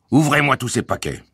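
high, peremptory command; bullying order. A narrator's reading. Guard orders father to open the packages, bullying. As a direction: order it like a puffed-up official.

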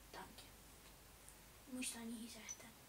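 breathy whispered aside to the viewers. Real, not acted. Gamer whispering a guilty aside to viewers.

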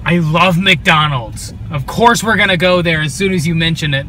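loud, hammed-up eagerness; loud mock-excited declaration; retold enthusiasm. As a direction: reenact an over-keen yes. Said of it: "And I was like".

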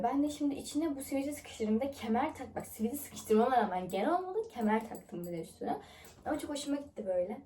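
ordinary talk

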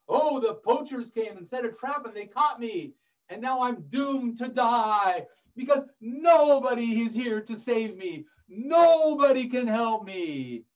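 high, hammed-up wail of doom; despairing lion. Trapped lion tells mouse of capture, melodramatic lament.

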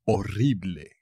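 ordinary talk, nothing marked

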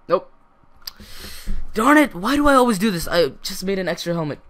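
high, loud exasperated yell fading down; frustrated outburst. Real, not acted. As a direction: scold yourself for a dumb mistake. Crafted an extra helmet.

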